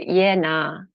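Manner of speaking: Australian "Yeah, nah". Shown as a demonstration. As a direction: show how Aussies say "Yeah, nah". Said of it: "We also go".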